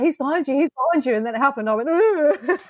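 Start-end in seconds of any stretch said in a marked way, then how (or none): 0.0-1.1 warning call. Retold or imitated. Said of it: "the whole time I was like". 1.9-2.6 startled ugh with a laugh; scared grunt. Retold or imitated. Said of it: "my scared noise".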